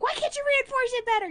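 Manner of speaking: high hammed-up scolding; shrill mock demand; wife scolding Sam over the tree, mock fury; mock outrage. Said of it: "I'm like".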